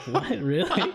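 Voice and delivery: high, amused "really"; laughing disbelief. Retold or imitated. Cyclist retelling his reaction to the record check, laughing.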